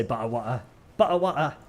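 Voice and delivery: put-on British accent with dropped t's; mock British accent, playfully imitated. Shown as a demonstration. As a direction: do your favourite British-accent bit. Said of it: "hanging out with British people is to just say".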